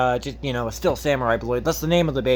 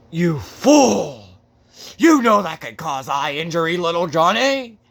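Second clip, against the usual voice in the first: hammed-up doctor, a bit louder, scolding; 4 dB louder than usual. Doctor scolds Johnny's mother, mock louder scolding. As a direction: play the doctor scolding the fool. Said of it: "the doctor just goes".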